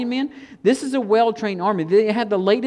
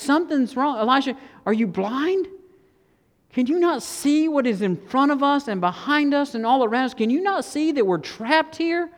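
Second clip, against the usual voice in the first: higher-pitched incredulous questioning; exasperated disbelief; 4 semitones higher than usual. Acted. Servant asks Elisha if he is blind, exasperated and incredulous. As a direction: challenge him as if he must be blind.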